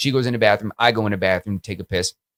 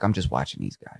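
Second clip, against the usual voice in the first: hushed aside; 10 semitones lower, 12 dB quieter than usual. Retold or imitated. Hushed reply to girlfriend at bar.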